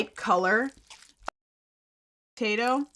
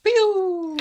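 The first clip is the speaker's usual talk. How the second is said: playful pew sound; 10 semitones higher, 6 dB louder than usual. Real, not acted.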